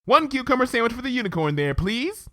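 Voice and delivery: Pinkie, cheerful; Pinkie voice, smiling. Acted. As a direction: order it brightly. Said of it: "she said with a smile".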